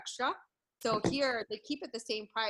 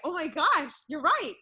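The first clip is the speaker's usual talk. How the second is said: higher, louder startled exclamation; surprised realization; 6 semitones higher, 8 dB louder than usual. Retold or imitated. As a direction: suddenly realize it's true. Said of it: "And I was like".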